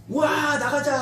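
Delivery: high, drawn-out whoop into a rally; rallying charge cry. Acted. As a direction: whoop and urge everyone forward. Acted troops rushing forward.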